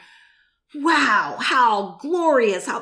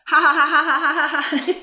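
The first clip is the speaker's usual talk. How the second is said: loud, higher forced ha ha ha; fake laugh; 5 semitones higher, 4 dB louder than usual. Shown as a demonstration. Preacher demonstrating to listeners how to start laughing, loud forced laugh. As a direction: force a laugh out until it turns real. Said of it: "you can fake laugh".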